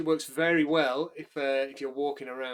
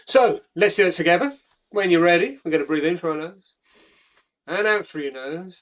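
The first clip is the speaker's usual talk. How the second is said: steady, louder step-by-step breath-work instructions; guided breathing cue; 4 semitones higher, 7 dB louder than usual. Acted. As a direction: lead the group through each step. Leads breath-hold exercise.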